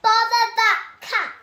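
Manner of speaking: loud, high exasperated snap; cross outburst. A narrator's reading. Mister Thomas fed up with the cat, loud exasperated outburst. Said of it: "he said".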